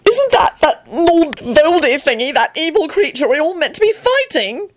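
high-pitched meek question; meek, hesitant high voice. A narrator's reading. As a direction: ask timidly in a very high voice. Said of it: "Antonin asked meekly".

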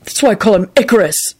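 a touch of nastiness; spiteful jab; nasty. A narrator's reading. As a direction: say it with mild spite. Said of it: "he said nastily".

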